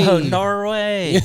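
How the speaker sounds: slow high laughing Norway; laughing drawl. Pretend. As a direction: stretch it out while laughing. Echoing the chant laughing.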